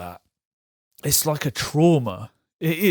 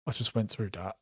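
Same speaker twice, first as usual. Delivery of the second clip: much softer, stunned murmur; dazed and quiet; 5 semitones lower, 12 dB quieter than usual. Retold or imitated. After the bathroom downstairs, a soft stunned murmur. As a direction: barely voice it, shell-shocked. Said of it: "I'm like".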